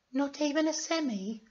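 high falsetto character; disappointed question in falsetto; very high put-on girly voice; Florence. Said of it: "said Florence".

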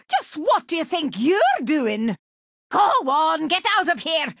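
normal talking voice

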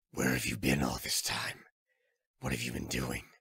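Snake's voice. Acted. Old soldier explains his pursuit to Drebin, low and weary. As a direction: drop into the tired veteran's low voice.

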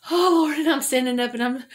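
high, loud cry of strain. Retold or imitated. Praying aloud while pushing up a hill, strained and loud. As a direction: call out to God mid-pedal.

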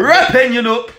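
loud, high hype shout; hyped-up echo. Retold or imitated.

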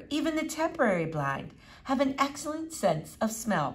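a touch of precocious lecturing; pompous boy. Acted.

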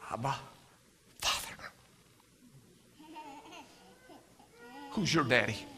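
whispered Father, very soft; hushed. Acted. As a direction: drop to a near whisper. Hushed close of sermon.

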